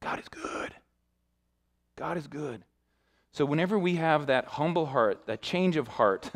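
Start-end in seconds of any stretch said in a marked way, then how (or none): 0.0-2.6 hushed, near-whispered praise; reverent. Real, not acted. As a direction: say it very softly, in awe. Reverent close of the testimony.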